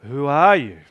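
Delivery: a lightly voiced drowsy caterpillar; hint of a sleepy caterpillar; languid, sleepy caterpillar. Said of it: "in a languid, sleepy voice".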